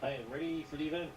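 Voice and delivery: fast, radio-filtered reply; sci fi communicator. Acted. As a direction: answer over a crackly link. Astronaut answers over radio.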